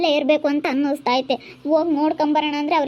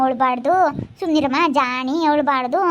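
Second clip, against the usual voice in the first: usual speaking voice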